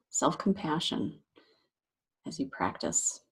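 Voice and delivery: unhurried meditation guide, long pauses between phrases; guided meditation. Acted. Meditation teacher guiding listeners to relax shoulders and jaw, guided meditation voice. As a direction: gently talk them into settling, leaving space after each phrase.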